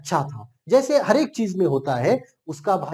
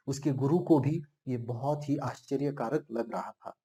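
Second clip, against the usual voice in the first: normal talking voice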